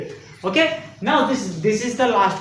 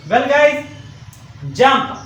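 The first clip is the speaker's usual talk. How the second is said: normal talking voice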